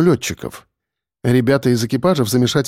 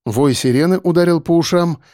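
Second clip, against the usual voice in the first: ordinary delivery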